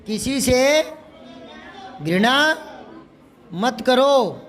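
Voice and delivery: word-by-word dictation with long pauses. Shown as a demonstration. Teacher dictating to writing pupils, broken up with pauses. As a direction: dictate in small chunks, rising until the last.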